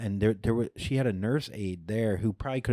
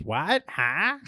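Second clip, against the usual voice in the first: confused grandma; 8 semitones higher than usual. Retold or imitated. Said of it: "she just goes".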